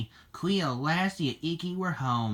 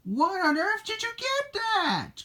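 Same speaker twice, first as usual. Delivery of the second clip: very high, alarmed question; anxious; 3 dB louder than usual. A narrator's reading. Cleo questions her daughter about the dinosaur, high anxious voice. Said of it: "Cleo asked anxiously".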